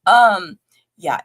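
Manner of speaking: ordinary delivery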